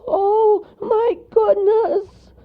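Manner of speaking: very high, loud, slow squeal; startled squeaky creature; shocked cry. A narrator's reading. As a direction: shriek it in alarm. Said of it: "he cried".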